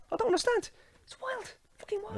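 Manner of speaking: very high, quiet exasperation; baffled disbelief. Real, not acted. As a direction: trail up into a small incredulous squeak. Baffled at his own rant's subject.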